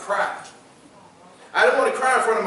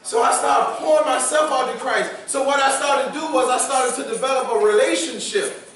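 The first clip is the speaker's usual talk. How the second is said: higher, louder testimony preaching; full-force preaching; 6 semitones higher, 3 dB louder than usual. Acted. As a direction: preach it out to the congregation.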